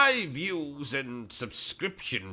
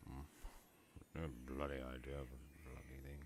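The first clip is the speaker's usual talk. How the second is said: very low, quiet, quick grumble; real off-hand low muttering; muttering to self; 12 semitones lower, 19 dB quieter, much faster than usual. Real, not acted. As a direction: grumble privately under your breath, barely audible. Grumbles to self away from the show.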